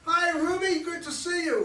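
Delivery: high, loud, gushing fake cheer; mocking her greeting. Mocking her wave at a friend, loud sugary cheer. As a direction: overdo her sugary hello to mock her. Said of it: "You weren't waving to a friend".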